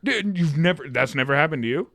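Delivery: usual speaking voice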